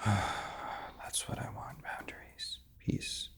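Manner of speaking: sighing whisper of what you want; soft sighing whisper to yourself; whispered inner voice. Shown as a demonstration. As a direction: breathe it out, barely voiced. Therapist demos inner voice, whispered.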